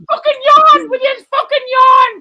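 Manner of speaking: high, full-volume comic shouting; yelled mock order. Host badgering co-host to yawn, mock-furious yelling.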